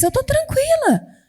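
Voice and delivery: high, light and at peace; serene calm. Retold or imitated. Believer at peace despite the late husband, lightly high and calm. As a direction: let it float up, untroubled. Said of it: "você começa a ver".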